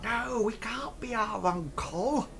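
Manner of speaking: high hushed goblin scolding. Acted. Goblin hushing his partner in front of the players. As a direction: hush him urgently. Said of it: "the other one says".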